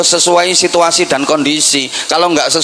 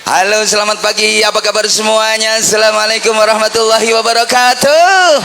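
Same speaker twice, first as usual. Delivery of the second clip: singer's stage greeting; 6 semitones higher than usual. Shown as a demonstration. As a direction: hype the crowd like a pop star on stage. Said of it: "salam saya pakai gayanya penyanyi".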